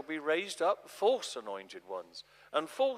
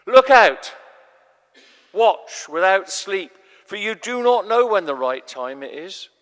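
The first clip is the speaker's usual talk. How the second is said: loud, urgent shout of alarm; urgent warning; 3 semitones higher, 14 dB louder than usual. Acted. Jesus warning his disciples to stay awake, urgent loud call. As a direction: rouse them sharply, Watch without sleep.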